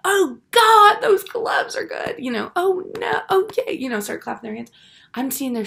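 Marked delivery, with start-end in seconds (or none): 0.0-2.2 much higher, slow gushing praise; shrill-free high mock gush over the gloves; imagined gushing girls. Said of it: "thinking they're gonna go". 2.6-3.7 much higher mock squeals of delight; imagined delighted girls. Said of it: "thinking they're gonna go".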